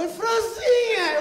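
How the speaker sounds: high, loud, slow "É franzinha" stretched out; slow insistence. Retold or imitated. Clerk insisting on the name, slow high and loud. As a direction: drag "franzinha" out slowly and loudly.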